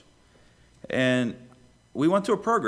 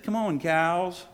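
higher, drawn-out sing-song call; sing-songy farmer; 5 semitones higher, slower than usual. Retold or imitated. Old farmer calling his cows from the barn, sing-songy. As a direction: call the herd in, slow and singsong. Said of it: "his sing-songy voice said".

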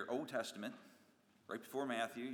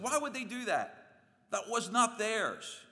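high, loud, slow outraged complaint; high and loud, stretch out the disbelief; indignant victim; 11 semitones higher, 7 dB louder, slower than usual. Acted. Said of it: "And you just said".

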